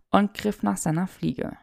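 ordinary delivery

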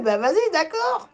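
put-on high, whiny old woman's voice; imitating old lady. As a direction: play a confused granny. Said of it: "Elle a fait".

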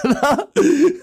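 sudden high, loud laugh; burst of laughter. Real, not acted. Laughs at his own remark.

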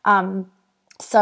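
ordinary talk

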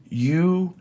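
high, incredulous You asshole; stunned insult. Retold or imitated. To the friend who photographed his card, a high shocked insult. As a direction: let it burst out in disbelief.

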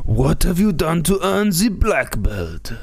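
put-on solemn master in a hammy Japanese accent. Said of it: "Two of the worst Japanese accents".

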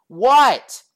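loud, high answering shout; called-back "what". Retold or imitated. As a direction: call back across the room. Calls back from behind partition.

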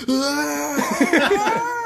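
high, loud mock scream; imitated farmer scream. Homeless man demonstrates his farmer scream to a stranger, imitated screaming. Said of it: "The guy just starts fucking screaming".

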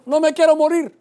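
loud, high cry for life; shouted plea. Retold or imitated. Preacher reliving his car crash, shouted plea. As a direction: yell it out high and desperate. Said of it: "Eso grité".

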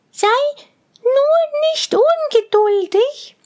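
sehr hohe, sanfte Mädchenstimme; hoch und beschwichtigend sprechen; beruhigendes Mädchen. A narrator's reading. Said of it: "sagte Schneeweißchen". (Schneeweißchen beruhigt den Zwerg, hoch und sanft)

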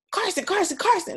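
loud, high urgent call across the house. Retold or imitated. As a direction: call him like it's an emergency. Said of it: "I just made it like such an emergency".

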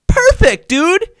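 very high, very loud cheer; ecstatic shout. Shown as a demonstration. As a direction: celebrate a perfect high five. Acts ideal high five reaction.

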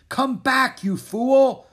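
high, loud commanding yell; ringing shout. A narrator's reading. The king calls the prince back, ringing shout. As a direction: ring it out across the ring. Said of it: "the king's voice rang out".

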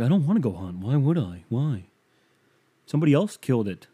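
mock whiny brush-off, lightly voiced; hammed-up petulant refusal, a touch fast at the end; playing up his own bitchy no with a sulky put-on voice. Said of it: "That sounded pretty bitchy".

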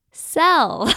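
high, loud reveal that breaks into a laugh; laughing punchline. Real, not acted. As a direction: land the word, then giggle. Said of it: "I'm laughing because this one comes up".

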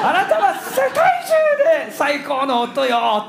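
loud, high, stretched-out mock scream. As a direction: scream it slowly as the adoring wife, at full volume. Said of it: "んだらどうでしょう".

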